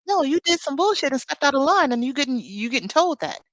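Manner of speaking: sassy callout. Retold or imitated. To someone who did her wrong, a loud callout. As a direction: tell them off, raising the voice. Calling out someone stepping out of line.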